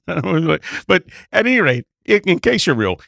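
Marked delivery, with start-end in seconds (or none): none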